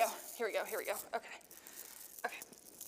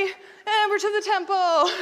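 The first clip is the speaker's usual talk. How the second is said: high, loud, drawn-out call; triumphant announcement; 7 semitones higher, 10 dB louder, slower than usual. A narrator's reading. Teacher marching children into the pretend temple, triumphant shout. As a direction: stretch it out like a finish-line cheer. Announces arrival in acted story.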